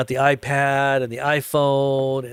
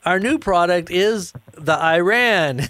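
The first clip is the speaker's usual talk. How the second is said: higher, slow grand announcement; mock product reveal; 5 semitones higher, slower than usual. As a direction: unveil it with pomp. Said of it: "he says".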